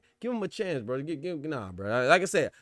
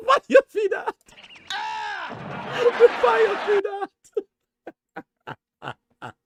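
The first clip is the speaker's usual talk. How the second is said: much higher, louder, slow squeal with laughter; 9 dB louder, much slower than usual. Real, not acted. To the co-host about [name], a high squealing laughing yell. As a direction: shriek it while cracking up. Cracking up, repeating the call.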